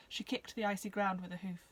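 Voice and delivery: ordinary delivery, nothing marked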